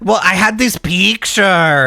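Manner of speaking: slow, exaggerated Mexican accent; mock Mexican cartoon iguana, stretching the vowels; hammed-up Mexican-accented puppet reply. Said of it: "fucking Speedy Gonzalez ass".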